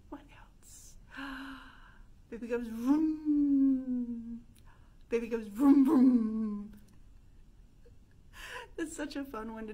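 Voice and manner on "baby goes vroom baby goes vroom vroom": loud buzzing lip vroom; revving vroom. Shown as a demonstration. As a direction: rev it up like an engine. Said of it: "make the vroom noise".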